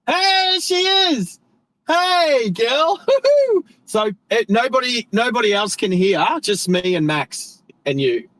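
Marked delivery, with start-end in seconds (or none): 0.1-3.0 put-on much higher, sing-song sassy hey girl; girly greeting. Greeting BT on the mod call in a much higher sassy girly voice. 3.0-3.6 much higher, louder burst of giggling; high squealing laugh. Real, not acted. Laughing at own put-on voice with BT, high and loud. Laughs after the girly greeting.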